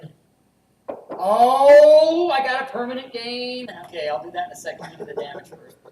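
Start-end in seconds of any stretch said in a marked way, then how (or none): 1.2-3.7 excited oh. Real, not acted. Excited at good roll.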